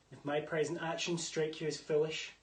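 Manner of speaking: normal talking voice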